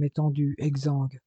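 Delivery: usual speaking voice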